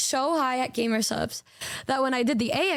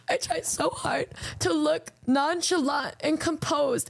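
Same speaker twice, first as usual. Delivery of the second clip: flustered whisper; slower than usual. Real, not acted.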